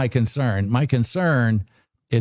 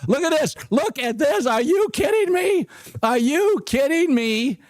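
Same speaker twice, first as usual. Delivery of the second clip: real excited shouting; 11 semitones higher than usual. Real, not acted. Trader watching his price jump, shouting with joy. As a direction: yell at the screen as the trade wins. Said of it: "If you guys are excited as me".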